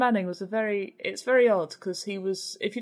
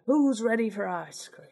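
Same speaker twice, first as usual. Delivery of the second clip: Bernard Manning impression; 3 semitones higher than usual. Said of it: "You sound like Bernard Manning".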